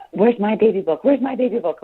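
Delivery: retelling her daughter's frantic search, high and loud; panicked child's cry. Retold or imitated. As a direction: call out like a scared child hunting for her book. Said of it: "Like, going in panic".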